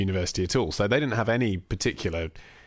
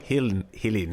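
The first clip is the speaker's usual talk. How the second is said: put-on South African accent. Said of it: "the South African accent".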